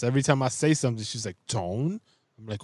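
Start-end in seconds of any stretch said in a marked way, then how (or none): 1.5-2.0 TONE drawn into a rising question; mock tone policing. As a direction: imitate a wife correcting with a rising "Tone?". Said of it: "tone Nazi in this house".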